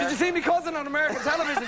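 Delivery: high, fast stage Irish voice; hammed-up Irish cousin bragging; an Irish villager boasting, high stage Irish accent. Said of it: "people with my head wandering around going".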